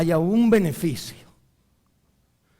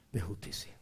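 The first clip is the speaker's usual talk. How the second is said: whispered, much softer; 7 semitones lower, 16 dB quieter than usual. Real, not acted. Preacher whispering the word justice to the church. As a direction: breathe it out intimately. Hushed ending.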